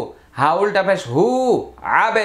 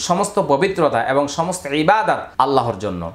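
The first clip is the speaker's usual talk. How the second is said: usual speaking voice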